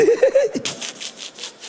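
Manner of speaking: airy high laugh at his own joke; breathy laugh. Real, not acted. Comedian laughing at his own joke, breathy laugh.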